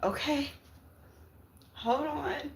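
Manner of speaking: high, soft tearful voice; crying. Real, not acted. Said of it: "seeing that I'm crying".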